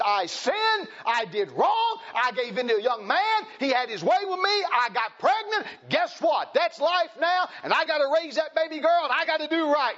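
high, loud, fervent declaration; grandma's resolve. Retold or imitated. Preacher voicing grandma owning her sin, loud and high. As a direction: proclaim it with fierce conviction.

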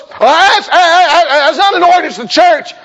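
high, loud, stammering protest; mock indignant objection. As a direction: splutter it like an outraged churchgoer. Acts out indignant objecting church folks.

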